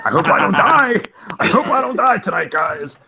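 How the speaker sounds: high, loud panicked cry; mock terror while driving; panicked yell. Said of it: "and he's fucking like".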